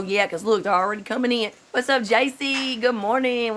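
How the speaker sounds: ordinary delivery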